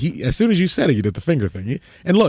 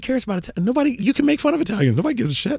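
high-pitched rapid outrage; high excited rant; 11 semitones higher than usual. Real, not acted. Heated mock outrage.

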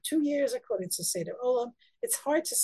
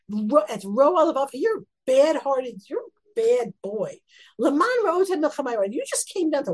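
scolding; 4 semitones higher, 5 dB louder than usual. Acted. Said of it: "he says to David very harshly".

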